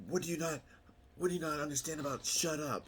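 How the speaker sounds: high, loud mock scolding; imitating an angry yell. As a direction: play the petty boss snapping at an extra. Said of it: "she starts screaming at everybody".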